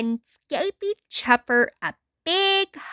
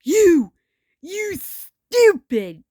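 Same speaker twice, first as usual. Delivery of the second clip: angry shouting; 4 dB louder, slower than usual. A narrator's reading. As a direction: rage at him in sputtering insults. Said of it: "shouted Ren".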